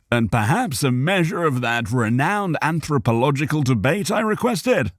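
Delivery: lightly voiced, pompous gentleman; Cal Asser voice. A narrator's reading. Cal Asser asks the dwarf for gossip, pompous.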